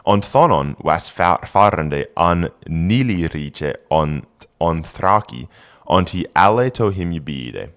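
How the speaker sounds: put-on careful Old English recitation. Shown as a demonstration. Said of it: "reading Old English text aloud".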